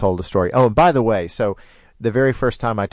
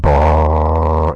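imitated engine rumble; 5 semitones lower, 7 dB louder than usual. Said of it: "and it was loud".

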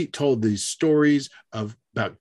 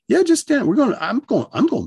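dismissive; 10 semitones higher, 4 dB louder than usual. Retold or imitated. Friend brushes off the stuck boy.

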